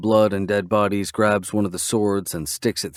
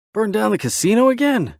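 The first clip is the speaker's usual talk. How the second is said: surprised; 13 semitones higher, 4 dB louder than usual. A narrator's reading. Knives shocked at burning plan.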